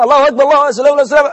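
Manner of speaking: high, loud, hurried takbir mocking a careless worshipper; mocking rushed prayer. Preacher mocking a careless worshipper's rushed prayer. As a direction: rattle off the takbir in mock haste. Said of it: "مش اللي عمال ينكر".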